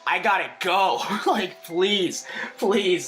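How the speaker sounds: begging through the door, half laughing; urgent plea. Retold or imitated. Retelling banging on the bathroom door, mock desperate plea.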